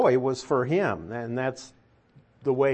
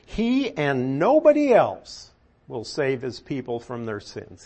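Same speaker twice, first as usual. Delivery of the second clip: teacher leans hard on "nobody" to show emphasis; stress on HE and NOBODY. Shown as a demonstration. Translating the verse for the class, stress on "nobody". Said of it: "That means it's emphatic".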